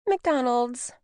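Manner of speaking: put-on offhand, take-it-or-leave-it child's reply; verbal shrug. A narrator's reading. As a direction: say it with a shrug, like a kid giving in. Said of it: "Anya shrugged".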